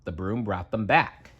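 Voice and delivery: plain reading voice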